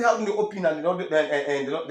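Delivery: indignant protest. Retold or imitated. Retold protest to officials.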